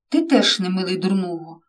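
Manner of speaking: usual speaking voice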